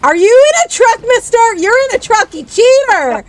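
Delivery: loud high teasing shout; playful accusing yell. Real, not acted. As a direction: call out the cheater loudly. Accusing friend of cheating.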